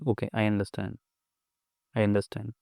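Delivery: quiet accepting murmur; calm soft self-talk. Shown as a demonstration. Showing how to answer a provoker, soft calm murmur. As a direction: say it gently to yourself. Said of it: "अभी बोलोगे".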